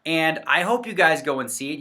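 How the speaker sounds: ordinary delivery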